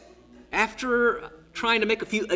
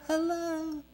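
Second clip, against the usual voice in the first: distant reply; 10 semitones higher, 7 dB quieter than usual. Retold or imitated. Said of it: "I heard a".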